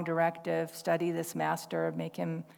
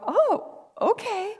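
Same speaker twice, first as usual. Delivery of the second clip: high, loud startled "oh okay"; taken aback by the young woman; surprised; 6 dB louder, slower than usual. Retold or imitated. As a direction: caught off guard and conceding. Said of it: "I go".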